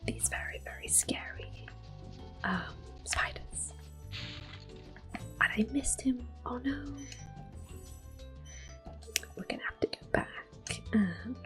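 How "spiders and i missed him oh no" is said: usual speaking voice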